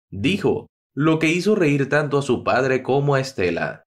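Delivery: plain reading voice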